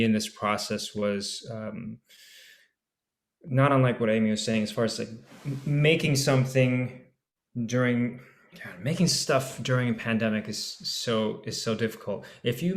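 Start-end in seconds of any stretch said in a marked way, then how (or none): none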